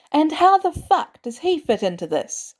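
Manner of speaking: a high, angry yell. A narrator's reading. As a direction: demand it at him angrily. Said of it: "May yells".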